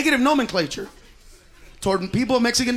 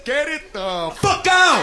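slow, booming mock God; 3 semitones higher, slower than usual. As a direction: deliver it like God losing patience. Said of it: "he says".

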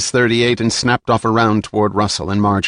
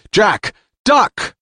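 warning shout; 12 semitones higher than usual. A narrator's reading. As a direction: yell to save him. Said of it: "Sam shouted".

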